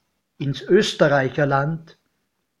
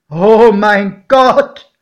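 slow, much louder cry, pitched up; alarmed cry; 5 semitones higher, 10 dB louder, much slower than usual. A narrator's reading. Godfather seeing the locomotive, crying out in fright. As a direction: gasp it out in terror. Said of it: "rief mein Pate".

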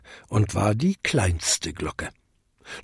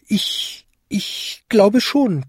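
high, halting little-bell voice; Vinzi, embarrassed; 10 semitones higher, slower than usual. A narrator's reading. Little bell answers Dong, high and embarrassed. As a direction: tinkle it haltingly, slow and embarrassed. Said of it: "klingelte Vinzi verlegen".

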